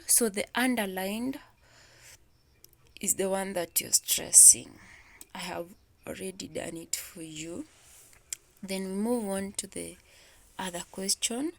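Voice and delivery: ordinary talk, nothing marked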